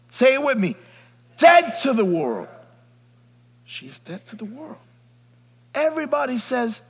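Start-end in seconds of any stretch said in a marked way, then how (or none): 0.1-4.8 full-force preaching. Acted. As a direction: preach it like a roll call of miracles.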